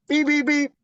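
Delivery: high-pitched beep noises; imitated beeping. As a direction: mimic a horn, high and quick. Imitating car horns while telling story.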